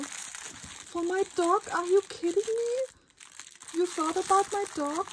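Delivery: high, loud squealing delight; excited squeal. Real, not acted. Thanking the sender for the dog toy, squealing high. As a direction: squeal with disbelief and joy.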